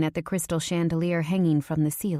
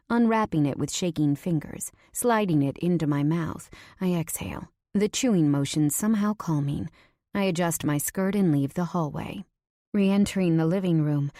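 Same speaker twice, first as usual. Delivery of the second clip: ordinary delivery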